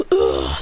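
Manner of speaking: low pained groan; acted hurt grunt; Zabuza. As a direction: groan low and rough, wounded. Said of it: "Zabuza goes".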